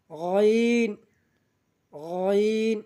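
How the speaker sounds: "গইন" from the far end of the throat; back-of-throat sound. Shown as a demonstration. Teacher showing learners the letter, "গইন" drawn from the throat's end. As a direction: draw out a throaty Arabic "গইন". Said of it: "হলকের শেষ ভাগ হইতে".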